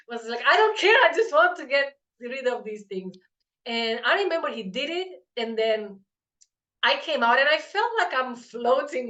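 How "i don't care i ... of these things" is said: high, loud "I don't care" pushing past it; retold insistence. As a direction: brush it aside and demand results.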